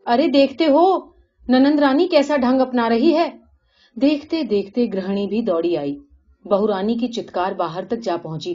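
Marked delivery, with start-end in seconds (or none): 0.1-3.3 high, loud alarmed call; crying out. A narrator's reading. Sister-in-law runs out calling the household, crying out. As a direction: call out for everyone to look. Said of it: "چتکار کرتی ہوئی دوڑتی باہر آ پڑی".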